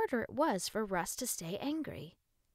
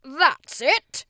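loud exclamation; 7 semitones higher, 14 dB louder than usual. A narrator's reading. New voice from behind.

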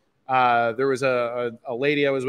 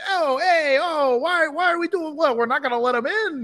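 much higher, exaggerated New Jersey accent; hammed-up New Jersey indignation, pitched way up; New Jersey guys. Randy and Ryan protesting the press pass refusal, high hammed-up New Jersey accent. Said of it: "who are both very sort of New Jersey".

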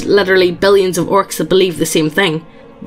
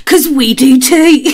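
high, slow, louder cheesy ad read; 5 semitones higher, 5 dB louder, slower than usual. Acted. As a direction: sell it like a cheesy advert. Hammed-up sponsor ad read.

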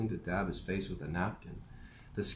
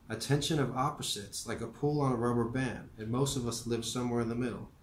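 put-on gentle elderly teacher voice, a bit higher; old professor voice; 4 semitones higher than usual. Narrator voicing Morrie.

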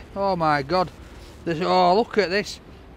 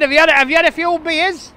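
repeated louder and spelt out for the man; 8 semitones higher, 8 dB louder than usual. Real, not acted. Repeating his question to the man who missed it, louder. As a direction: say it again, louder, so he hears. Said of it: "Can't understand me".

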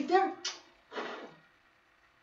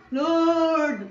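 drawn-out, higher mock cry to God; imitated crying out; 6 semitones higher, 5 dB louder than usual. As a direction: cry out like a repenting man, louder. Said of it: "and he cried".

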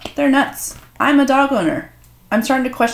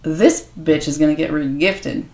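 usual speaking voice

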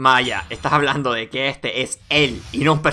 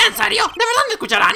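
very high eager clown voice; hopeful clown; faster than usual. Acted. Clown hopes court will listen, high-pitched eagerness. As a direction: ask in delighted, high-pitched disbelief.

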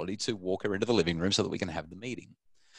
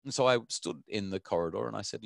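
usual speaking voice

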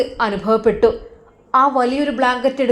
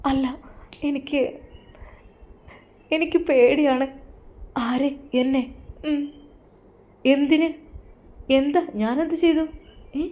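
put-on frightened young wife; 3 semitones higher, 5 dB quieter than usual. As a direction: plead softly, scared of him. Frightened wife voiced higher.

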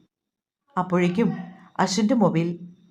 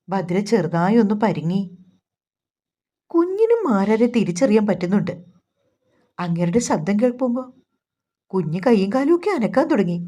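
usual speaking voice

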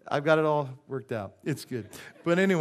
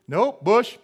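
louder curt Bush, pinned on him; mocking blamer; 5 semitones higher, 10 dB louder than usual. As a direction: shrug it off by blaming Bush. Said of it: "one person said".

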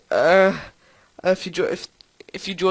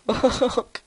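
ordinary delivery

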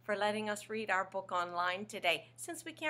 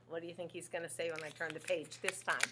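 plain reading voice

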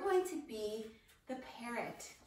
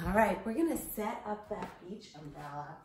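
plain reading voice

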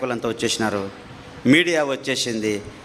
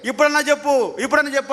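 angry demand; 11 semitones higher than usual. Retold or imitated. Torturer demands denial of Jesus.

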